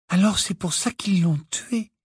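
put-on high-pitched, questioning girl; Hermione's earnest voice. A narrator's reading. Hermione realising why a man was killed, earnest. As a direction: pitch well up, working it out.

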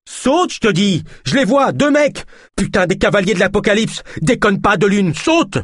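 high-pitched, frantic shouts; panicked urging. Acted. Naya sees riders and urges De Lune aboard, frantic. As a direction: beg and order him to jump now.

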